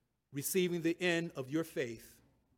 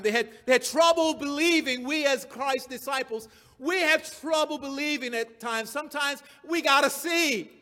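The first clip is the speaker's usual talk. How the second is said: raised, emphatic pulpit voice; forceful preaching; 7 semitones higher, 8 dB louder than usual. Acted. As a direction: drive the point home to the congregation.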